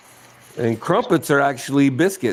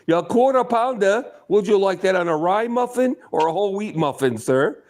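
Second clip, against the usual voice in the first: lightly voiced fast-food server, pitched higher; mock waiter; 6 semitones higher than usual. Mocking British burger muffins as a polite fast-food server. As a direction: play a polite server taking an order, lightly.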